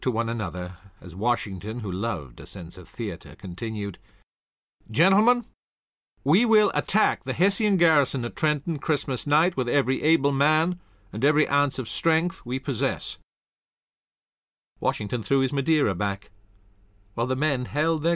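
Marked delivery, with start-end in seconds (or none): 4.9-13.2 commanding announcement. Acted. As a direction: proclaim the attack with theatre. Washington announces the attack.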